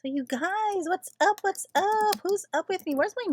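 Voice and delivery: high, loud hype greeting; excited call-out. Real, not acted. Livestream host rousing late-night viewers, loud and excited. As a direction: call out brightly to rally the audience. Calling out to live-stream viewers.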